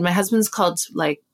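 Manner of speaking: ordinary talk, nothing marked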